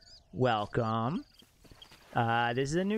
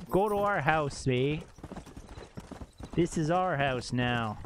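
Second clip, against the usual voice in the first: ordinary delivery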